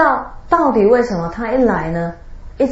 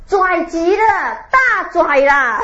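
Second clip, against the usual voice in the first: slow, drawn out through laughter; laughing, stretched-out punchline; 8 semitones higher, slower than usual. Real, not acted. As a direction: savor the punchline while laughing.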